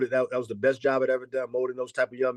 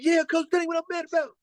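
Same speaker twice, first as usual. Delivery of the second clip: much higher, fast put-on young player voice; imitating a young player, much higher and fast; player's voice; much faster than usual. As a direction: voice the kid high and quick, brushing it off. Coach voicing his player.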